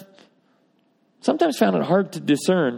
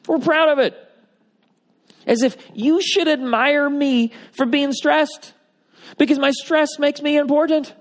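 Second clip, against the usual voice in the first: mock proud voice, much higher; mock self-importance; 12 semitones higher, 3 dB louder than usual. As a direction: parody someone boasting of stress. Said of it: "wear our stress like a badge".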